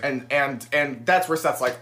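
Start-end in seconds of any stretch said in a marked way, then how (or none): none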